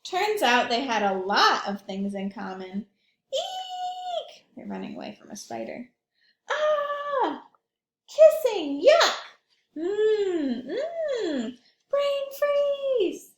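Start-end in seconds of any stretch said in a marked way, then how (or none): 3.3-4.5 scared shriek. Acted. Sam yells in fright at Carrie, high shriek. Kids run from spider. 6.5-13.3 high, loud cartoon cries; high excited exclamations. Sam and Carrie react to spiders, kissing and ice cream, high excited cries. Kids react together in story.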